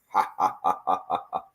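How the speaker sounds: low, spaced-out mock laugh. Host joking about rock-star glasses, low imitated laugh. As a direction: drop low for a deadpan ha-ha impression. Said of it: "[name] glasses and go like".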